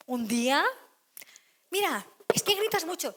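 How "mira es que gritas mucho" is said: complaint. Retold or imitated. Family member complaining about yelling.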